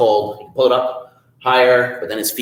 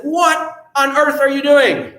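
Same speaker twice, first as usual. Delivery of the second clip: amazed Jack; 12 semitones higher, 4 dB louder, slower than usual. Acted.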